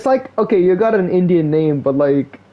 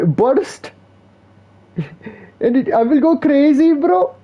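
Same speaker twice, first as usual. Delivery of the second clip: much higher, gushing mock Indian accent; 10 semitones higher than usual. Mock streamer bragging about pulling Ganyu, much higher Indian accent. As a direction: play a giddy Indian gamer bragging about a pull. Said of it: "every Indian can do the Indian accent".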